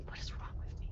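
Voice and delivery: unvoiced self-mocking aside; whisper. Real, not acted. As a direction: whisper to yourself.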